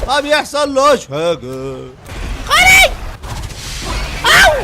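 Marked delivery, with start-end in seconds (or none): 2.5-4.6 very high loud yelp; sudden jolt of alarm; high loud cry; startled shriek. Real, not acted. Startled in fight.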